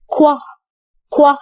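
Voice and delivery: كوا said as a crisp kw; kw sound. Shown as a demonstration. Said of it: "صوت ال Q إيه؟". (صوت كوا واضح)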